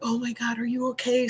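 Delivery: hushed, frightened apology to the couple. Retold or imitated. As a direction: breathe the apology out softly. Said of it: "I can feel her spirit, like".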